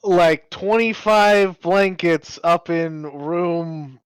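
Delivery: calling to front desk. Acted. Placing an order with the concierge desk, calling out slowly. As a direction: call it down a speaking tube, slowly.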